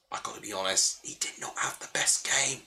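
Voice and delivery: soft, breathy whisper of a confession; whispered aside. Real, not acted. Streamer whispering a blunt verdict on a player. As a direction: lean in and whisper it like a secret.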